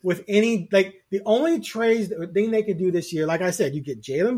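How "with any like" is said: ordinary talk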